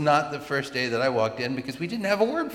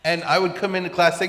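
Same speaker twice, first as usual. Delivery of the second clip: ordinary delivery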